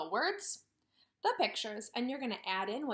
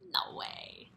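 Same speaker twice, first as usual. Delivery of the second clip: a hushed mock disbelief; whispered no way. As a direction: say it like a secret to the kids. Answers her own question.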